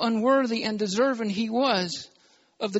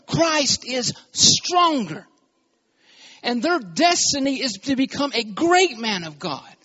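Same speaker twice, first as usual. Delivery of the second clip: fervent preaching; 3 semitones higher, 4 dB louder than usual. Acted. Preacher builds to full force.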